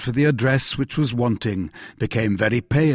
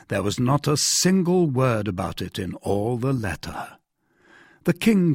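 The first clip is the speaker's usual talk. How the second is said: usual speaking voice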